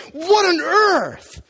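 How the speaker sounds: high, loud, slow stunned disbelief; astonished. Retold or imitated. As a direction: sound knocked out of your senses. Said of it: "They're astonished".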